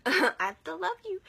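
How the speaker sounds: laughing, high and loud. Real, not acted. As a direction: laugh through the words. Laughing at teasing her dad.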